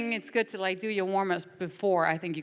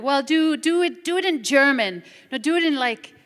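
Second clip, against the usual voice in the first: higher, louder mock demands; imitated pushy directors; 7 semitones higher, 8 dB louder than usual. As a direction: mimic directors firing off orders at an actor. Acted demands from booth directors.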